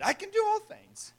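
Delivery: high, chirpy mock quote of the verse; mock half-quote. Shown as a demonstration. Preacher mocking people who half-quote Paul, high and chirpy. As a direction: toss off the half verse as a joke. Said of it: "Just quote a little bit of it".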